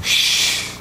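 a dismissive whispered shush. Retold or imitated. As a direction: hush her like a child. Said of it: "I went".

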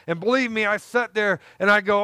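usual speaking voice

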